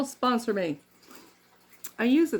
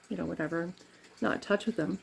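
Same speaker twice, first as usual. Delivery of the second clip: usual speaking voice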